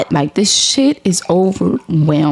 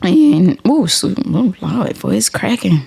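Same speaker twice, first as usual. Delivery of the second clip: ordinary talk, nothing marked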